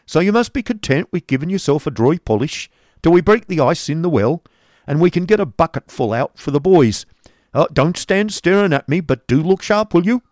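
loud, gruff headmaster voice; Squeers waking him. Acted. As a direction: bark him out of bed. Squeers orders Nicholas about.

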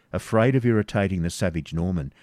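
ordinary delivery

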